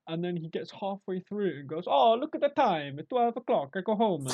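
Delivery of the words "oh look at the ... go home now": high, loud comic Italian worker; Italian accent. Acted. Lazy Italian worker putting off an order, mock Italian accent. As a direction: play a hammed-up Italian slacker. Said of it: "I say Italian".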